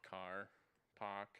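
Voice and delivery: "car" and "pock" with the R left off; dropped R's. Shown as a demonstration. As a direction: say "car" as "cah" and "pock" for park, softly. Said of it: "they always drop their R's".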